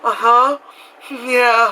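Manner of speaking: high, politely faked agreement with a nervous chuckle; feigned niceness. Just being nice to a chatty lady, feigned agreement. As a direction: humour them with a fake little laugh. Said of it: "to be nice".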